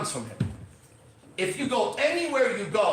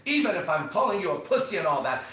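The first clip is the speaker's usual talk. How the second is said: ordinary talk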